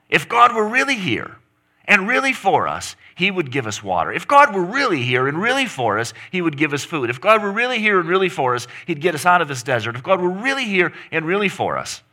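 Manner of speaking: high, loud hammering repetition; driving sermon refrain. Real, not acted. Preacher voicing Israel's demands, rising refrain. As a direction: pound the refrain each time.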